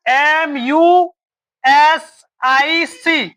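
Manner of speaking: letters spelled out very slowly; slow classroom spelling. Shown as a demonstration. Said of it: "ध्यान देना है".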